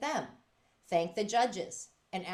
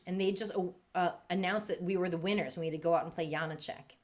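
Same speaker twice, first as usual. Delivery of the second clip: ordinary delivery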